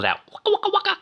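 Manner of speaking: high, sung "waka, waka" like a guitar pedal; a quick vocal wah-wah riff; wah-wah pedal imitation. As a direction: mimic the seventies wah-wah guitar. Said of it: "that little wah-wah pedal".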